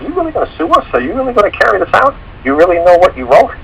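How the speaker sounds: squeaky high disbelieving judge; high-pitched mocking of a stunned judge. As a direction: mimic the judge in a squeaky, incredulous falsetto. Incredulous judge quoted in story.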